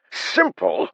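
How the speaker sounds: loud, falling exasperated shout. A narrator's reading. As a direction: hurl it back, exasperated. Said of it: "Haber practically screamed".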